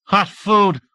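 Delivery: light up at the thought of food; eager cry. A narrator's reading. Hungry deputy greeting the supply team, eager cry.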